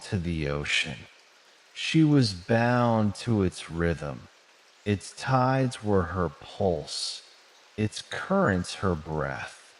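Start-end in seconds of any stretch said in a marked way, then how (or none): none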